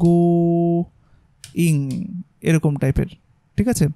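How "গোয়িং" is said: Going stretched very slowly, sound by sound; drawn-out demo word. Shown as a demonstration. Teacher showing how to write going the way you text a friend, very slowly. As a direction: drag out going as if spelling it by ear. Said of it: "যেভাবে আপনি আপনার বন্ধুকে লেখেন".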